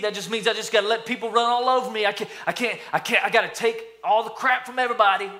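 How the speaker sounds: high-pitched, rushed mock-helpless whine; doormat. Someone who thinks meek means doormat, complaining in high mock helplessness. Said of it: "they think that being meek turns you into a doormat".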